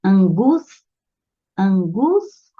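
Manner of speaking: "angústia" com o GÚS esticado; sílaba tônica destacada. Shown as a demonstration. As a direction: pare depois de "Angus-" para marcar a tônica. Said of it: "aí ela é paroxítona". ("angústia" dividida, com peso no GÚS)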